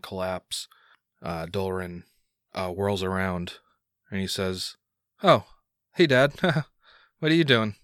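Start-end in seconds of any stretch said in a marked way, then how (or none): 5.2-7.7 higher, louder cheerful son voice; bright, questioning greeting to dad; upbeat son. Acted. Son greets his dazed dad, upbeat and higher.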